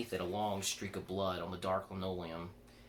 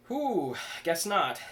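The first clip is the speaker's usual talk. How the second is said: high, loud flustered whew; startled; 9 semitones higher, 7 dB louder, much slower than usual. Acted. Chris reacts to belt yanked.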